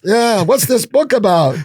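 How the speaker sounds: the rabbi insists in a big, high, drawn-out voice. Retold or imitated. The rabbi presses the guest about his book, hammed up high and slow. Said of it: "the rabbi does the rabbi thing".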